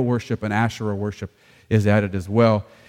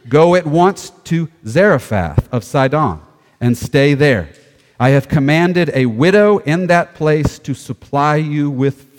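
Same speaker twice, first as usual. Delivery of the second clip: projected, deliberate proclamation; scripture reading; 5 dB louder, slower than usual. Acted. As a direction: proclaim the Lord's command to the congregation. Reads scripture aloud.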